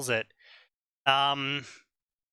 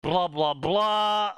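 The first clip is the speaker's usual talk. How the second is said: ordinary delivery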